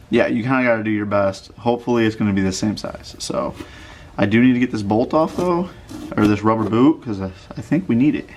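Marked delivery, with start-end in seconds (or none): none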